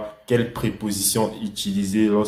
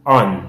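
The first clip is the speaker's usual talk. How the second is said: on said alone, louder, as the key word; stressed answer word; 7 dB louder than usual. Shown as a demonstration. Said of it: "la préposition ... est".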